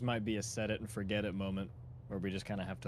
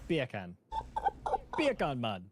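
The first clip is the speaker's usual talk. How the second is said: beer can as bia kahn, Jamaican; Jamaican accent; 3 semitones higher, 3 dB louder than usual. Shown as a demonstration. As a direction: say it like Jamaican bacon. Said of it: "Yeah, see?".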